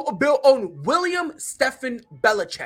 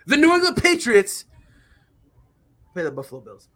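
grand entrance announcement; 2 semitones lower, 5 dB louder than usual. Pretend. Announcing the matchup.

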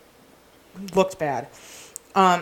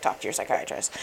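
low, soft, unvoiced; hushed firm advice; whispered advice; 11 semitones lower, 8 dB quieter than usual. Priest advising her, whispered.

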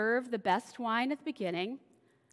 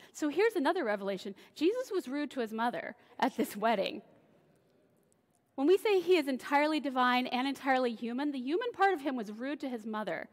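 ordinary talk

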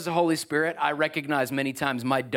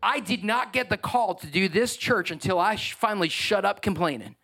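normal talking voice

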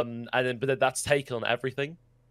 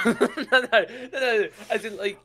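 high, loud laughing denial; laughing protest; 10 semitones higher, 4 dB louder than usual. Real, not acted. Backtracking to a friend about how old he looks, laughing protest. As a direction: backtrack hurriedly through laughter.

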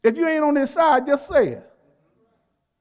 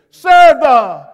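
louder, full-force shouted proclamation; emphatic shout; 11 dB louder than usual. Shown as a demonstration. Preacher shows the exclamation point, shouting it out. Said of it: "we say it with emphasis".

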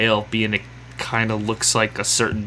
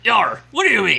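pirate voice; 10 semitones higher, 3 dB louder than usual. Acted. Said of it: "stereotypical pirate voice".

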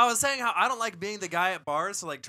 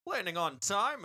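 pilot over the radio; 6 semitones lower, 7 dB quieter than usual. Pretend. Captain announcement continues.

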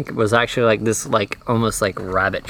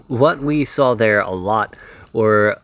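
usual speaking voice

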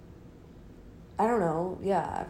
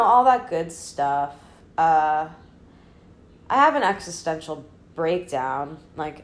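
ordinary delivery

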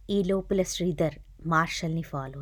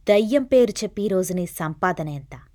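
louder, accusing question; loud demand; 2 semitones higher, 8 dB louder than usual. A narrator's reading. Detective accusing the fraud priest, loud interrogation. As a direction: push the accusation hard. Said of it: "అని గట్టిగా అడిచాడు".

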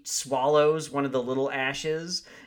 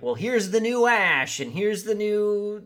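higher, louder showy reveal; mock announcement; 6 semitones higher, 4 dB louder than usual. Host mocking a remake trying to hand fans a new Ash. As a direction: present it like a cheesy reboot pitch. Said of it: "try to be like".

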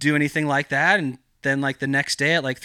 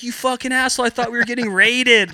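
very high, louder imitated scolding; furious relief; 9 semitones higher, 5 dB louder than usual. Wife scolding him after a fake raid scare, imitated angry yell. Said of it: "she was in full raid mode".

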